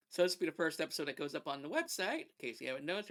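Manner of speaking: usual speaking voice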